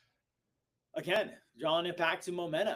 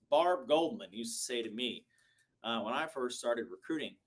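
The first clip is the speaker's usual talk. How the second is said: ordinary talk, nothing marked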